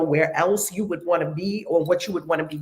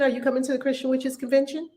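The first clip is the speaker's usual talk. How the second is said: usual speaking voice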